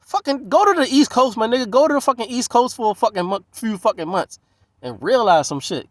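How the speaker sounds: loud, high, heated telling-off; loud heated rant at the locals; retold yelling. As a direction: yell it at them, fed up. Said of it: "I'm like".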